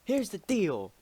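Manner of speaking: rally them with a grin; mock leader speech. Leader addresses rebels, quoted.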